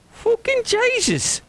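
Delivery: astonished Irish outburst. Acted. Pump attendant shocked by Tiger's answer, high Irish cry. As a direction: gasp it out in shock.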